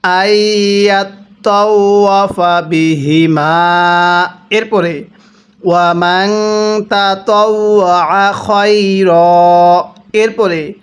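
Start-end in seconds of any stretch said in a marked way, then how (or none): 0.0-4.3 slow, drawn-out tajweed chanting; slow Quran recitation. Shown as a demonstration. Teacher demonstrating recitation to students, slow chant. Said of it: "এরকমভাবে শিখব". 5.6-9.8 slow, drawn-out tajweed chanting; slow Quran recitation. Shown as a demonstration. Teacher demonstrating recitation to students, slow chant. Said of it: "এরকমভাবে শিখব".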